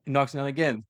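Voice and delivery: usual speaking voice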